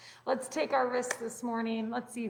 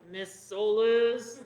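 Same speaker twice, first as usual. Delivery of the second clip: loud, drawn-out mock teacher's boom; booming teacher yell; 6 dB louder, much slower than usual. Physics teacher calling out a student, mock booming yell. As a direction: imitate a teacher booming out a name slowly. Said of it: "he would yell at me".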